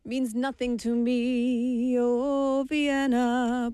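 slow, stretched mock lament; slow theatrical drawl; drawn-out sing-song. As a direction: drag it out like an old song lyric. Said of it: "that's how the young people talk".

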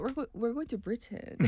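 softly imitating a formal announcement; mock formal. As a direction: mimic someone suddenly getting formal. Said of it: "You got all formal all of a sudden".

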